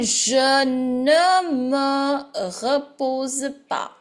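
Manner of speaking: syllable by syllable, drawn out and careful; slow model reading. Shown as a demonstration. Teacher reading it for students, slow and drawn out. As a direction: read it very slowly, stretching each small word. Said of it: "I'm going to read it once again".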